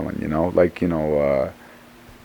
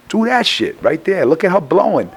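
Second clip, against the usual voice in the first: excited urging; 10 semitones higher than usual. Retold or imitated. Urging singer at video.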